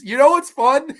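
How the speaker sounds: high, loud, laughing it out; can't get the words out for laughing. Real, not acted. Cracks up mid-sentence.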